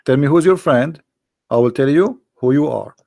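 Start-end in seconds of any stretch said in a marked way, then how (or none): none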